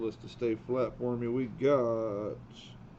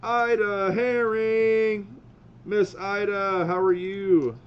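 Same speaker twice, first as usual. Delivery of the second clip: calling out; 9 semitones higher, 7 dB louder, slower than usual. Real, not acted. Greeting a viewer by calling out.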